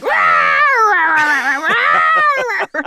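high, loud playful yelling; dog yelling. Retold or imitated. Dog yelling at his playmate, loud and high. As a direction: yell it like a dog mid-play. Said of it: "yells at him".

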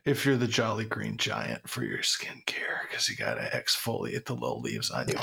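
low, unvoiced whisper, trailing under the breath; whispered aside. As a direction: whisper the tail end of the riff. Riff dropped into an aside.

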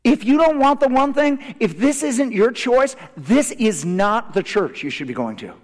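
rally the congregation to its calling; full-force preaching. Real, not acted.

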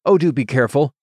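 a mild read-aloud shout, fretful. A narrator's reading. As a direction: call out fearfully to a fencer. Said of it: "shouted out".

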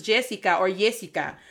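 Jessica as JEH-see-ka, then YEH-see-ka; Spanish pronunciations. Shown as a demonstration. Speaker showing how Spanish speakers say the name, Spanish vowels. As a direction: give Jessica two Spanish forms, J then Y. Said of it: "it's pronounced as".

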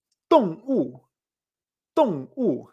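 动物 said slowly as two dry falling blows; 动物 slow, each syllable clipped falling; two dry falling tones. Shown as a demonstration. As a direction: model 动物 like two sharp shots. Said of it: "como si fueran dos golpes secos".

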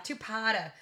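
two-parter in a mock New England accent, R dropped. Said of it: "say it with a New England accent".

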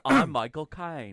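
Michael Caine impression. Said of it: "The first thing you say is".

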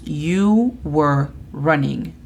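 slow, clearly spaced English; slow model phrase. Shown as a demonstration. Teacher modelling the past tense, slow and clear. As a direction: say it slowly so learners can copy it. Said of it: "Podemos utilizarlo con verbos".

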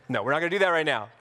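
ordinary talk

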